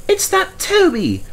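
high indignant engine voice; protesting. A narrator's reading. Mavis protesting to the manager, high and whiny. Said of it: "protested Mavis".

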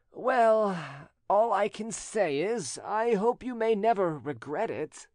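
high-pitched, slow, sighing concession; resigned sigh. A narrator's reading. Rejected suitor to his cousin on her choice, sighing. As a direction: sigh it out as a grudging wish. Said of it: "and here he sighed".

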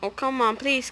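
normal talking voice

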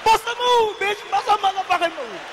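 high-pitched, worked-up confrontation; acted angry challenge. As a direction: square up for a fight in a high yell.

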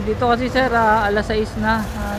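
ordinary talk, nothing marked

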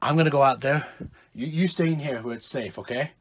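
low, soft, careful instruction; hushed reassurance. Acted. Dad tells kids to stay safe, hushed. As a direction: speak very quietly while hiding.

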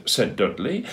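ordinary delivery, nothing marked

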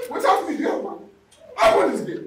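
loud, bossy order to the gatekeeper; demanding at the gate. Retold or imitated. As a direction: boom it out like the one in charge. Demanding entry at bank.

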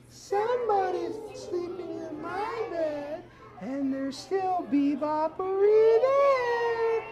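put-on high, slow baby bear. Shown as a demonstration. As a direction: draw it out like a tiny baby bear. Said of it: "A one, two, three".

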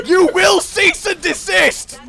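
high, slow command without shouting; exasperated command. Real, not acted. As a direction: draw the order out at a high pitch. Ordering opponent to stop.

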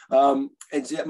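ordinary talk, nothing marked

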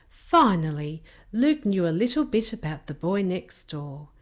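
ordinary delivery

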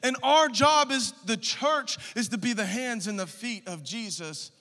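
loud, high preaching at full force; fiery preaching. Acted. Pastor urging the congregation, loud fiery preaching. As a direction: preach it hard to the church.

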